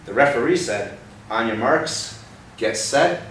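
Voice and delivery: lively picture-book narration; storybook reading. Acted. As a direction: read the race like an exciting story.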